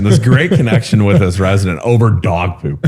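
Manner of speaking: ordinary talk, nothing marked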